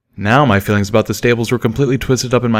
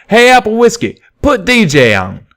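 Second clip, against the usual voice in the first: much higher and louder call across the bar; 12 semitones higher, 6 dB louder than usual. A narrator's reading. Buck calls to the bartender to put the radio on, loud. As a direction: holler it down the counter. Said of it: "called out".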